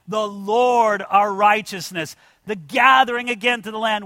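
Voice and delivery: ordinary talk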